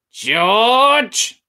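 high, loud screech from afar; shrill old woman calls out; screechy grandma calling. Acted. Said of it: "came the screechy voice from the next room".

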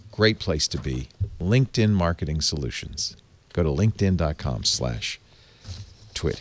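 smooth, measured sponsor read; upbeat podcast ad spot, unhurried. Acted. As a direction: sell it like a friendly host ad. Host reads a sponsor ad.

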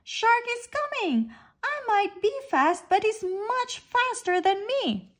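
small fish warning friends of the shark, scared; frightened. A narrator's reading. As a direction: blurt it out in fear. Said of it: "cries Sprat".

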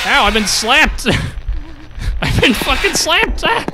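high, loud, slow indignant cry; outraged yelp. Real, not acted. Player just slapped by a ghost, outraged yelp.

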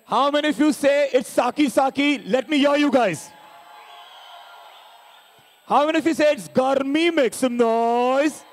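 rising, stretched crowd call; hyping the crowd. Real, not acted. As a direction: pump up the audience. Host hyping crowd on stage.

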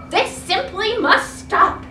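shouted. Acted. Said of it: "he shouted".

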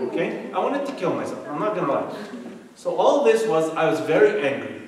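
ordinary delivery

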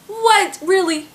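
high, stunned "What? Really?"; shocked disbelief. Retold or imitated. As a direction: act floored by the question. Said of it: "I was just like".

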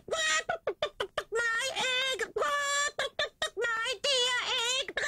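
very high, piercing shriek with a crying edge; shrieking hen. Acted. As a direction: wail it out like a frantic hen over her egg. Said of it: "she shrieked".

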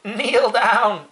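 ordinary delivery

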